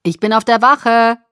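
loud call across a distance; calling after. Acted. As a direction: call it out to someone leaving. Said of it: "rief Charlie mir nach".

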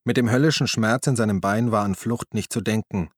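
ordinary delivery, nothing marked

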